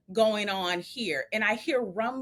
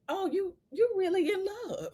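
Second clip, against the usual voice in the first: higher, amused dad voice; imitating dad; 10 semitones higher than usual. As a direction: play dad teasing his daughter. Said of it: "my dad was like".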